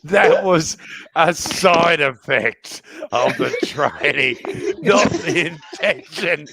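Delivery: slow, laughing through it. Real, not acted.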